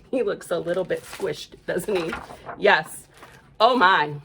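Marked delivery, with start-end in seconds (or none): none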